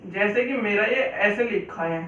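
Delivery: ordinary delivery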